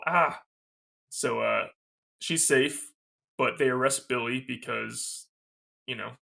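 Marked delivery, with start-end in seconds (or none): 0.1-0.4 short high yelp of a scream; imitating a startled little shriek; mock little scream. Imitating Dewey startled at the front door, little mock scream. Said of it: "I love his little".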